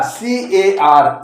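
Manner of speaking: C-A-R spelled slowly, each letter apart; "सीएआर" read as three separate letters, slowly. Shown as a demonstration.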